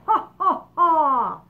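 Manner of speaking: loud high mock ha ha ha; belly laugh, loud and high; roaring laughter. All the creatures laugh at the boy, loud. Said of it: "There was a roar of laughter".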